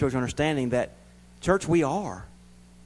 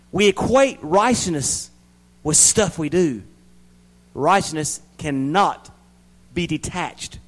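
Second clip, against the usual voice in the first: louder, slow and measured; slow emphatic preaching; 5 semitones higher, 6 dB louder, slower than usual. Acted. Preacher slowly driving home righteousness to the church, slow and loud. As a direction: lean on each word.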